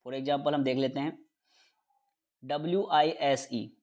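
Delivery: ordinary delivery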